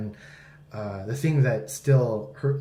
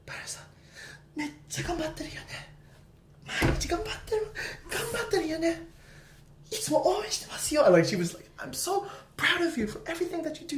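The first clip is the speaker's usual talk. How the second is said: much higher, soft teacher's encouragement; gentle sensei voice; 13 semitones higher, 6 dB quieter than usual. Retold or imitated. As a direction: quote her softly, high and kind. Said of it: "and she says".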